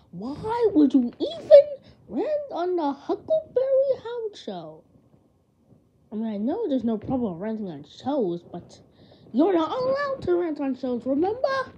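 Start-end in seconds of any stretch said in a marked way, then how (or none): 0.1-4.8 much higher, louder outraged yell; furious scolding. Acted. Relative scolding the kid for the rant, high angry yell. 9.3-11.7 scolding. Acted. Relative scolding.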